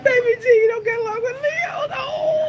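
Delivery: loud, high mock-outraged cry; excited shout. Real, not acted. Shouting, laughing at a comment.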